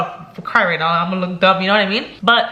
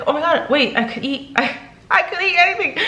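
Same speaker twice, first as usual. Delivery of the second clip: excited disbelief; 7 semitones higher than usual. Retold or imitated. Cleared to eat all foods.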